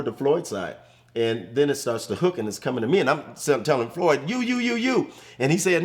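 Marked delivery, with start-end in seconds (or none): 4.3-5.1 much higher, urgent repeated yelling of you; panicked rookie shouting at his teammate to take the ball; frantic retold call.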